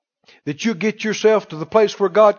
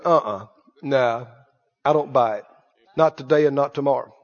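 low, dismissive rebuttal; flat refusal; 6 semitones lower, 4 dB quieter than usual. Real, not acted. Pastor to the excuse-maker: low firm refusal.

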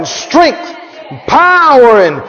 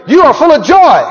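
said to the mirror, a bit louder and higher; self-affirmation; 4 semitones higher, 4 dB louder than usual. Acted. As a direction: tell yourself firmly you're full of joy. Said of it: "look at yourself and say".

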